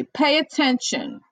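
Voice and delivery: ordinary delivery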